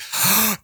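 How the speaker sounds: sharp, high startled inhale; acted shocked intake of breath; mock gasp. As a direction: gasp as if caught by a scare. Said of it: "and then you're like".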